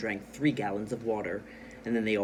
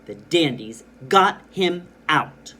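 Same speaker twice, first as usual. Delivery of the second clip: GOT, HIM, OUT each hit loud and slow; stressed words; 2 semitones higher, 9 dB louder, slower than usual. Shown as a demonstration. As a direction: punch GOT HIM OUT word by word. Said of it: "I stressed the big words".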